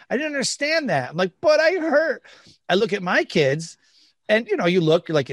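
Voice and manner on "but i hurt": high-pitched mock child's protest; hurt child. Adult imitating himself as a hurt child, high protest.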